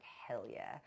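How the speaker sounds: excited whisper; whispered cheer. Retold or imitated. She celebrates getting the last pink bag, whispered. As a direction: hiss the victory quietly.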